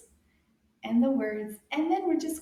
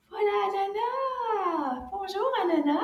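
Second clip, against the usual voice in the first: excited welcome; 9 semitones higher, 3 dB louder than usual. Pretend. Teacher greets Anana as she appears, excited high welcome. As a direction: sing out a big hello for children.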